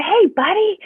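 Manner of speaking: put-on high, loud hello to a child; sing-song, high-pitched friendly hello; retold cheery greeting. Teacher greeting her pupil in story.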